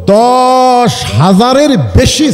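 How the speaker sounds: "দশ" drawn out long and slow; emphatic number. Real, not acted. Preacher telling congregation how many companions lie buried, emphatic. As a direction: stretch "দশ", then punch "হাজারের বেশি".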